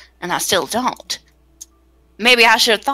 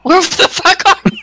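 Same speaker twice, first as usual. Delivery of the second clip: calling out loud through laughter, higher; laughing shout; 7 semitones higher, 4 dB louder than usual. Acted. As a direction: yell it out half-laughing.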